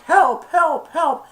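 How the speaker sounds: a terrified little monkey calling; high loud frantic help; panicked cries for help. Retold or imitated. Abu panics on the slide.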